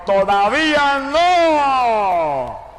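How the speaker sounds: very slow, high, loud "¡Todavía no!" with a long drawn-out no; God's thundering command, heard in the night; booming divine voice. Retold or imitated. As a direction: stretch "¡Todavía no!" out slowly and thunder it. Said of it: "y la voz me gritó".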